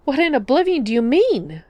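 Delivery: high, alarmed outcry; cried out. A narrator's reading. Father crying out at news of his daughter's death. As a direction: demand it in shock. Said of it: "Munthen cried".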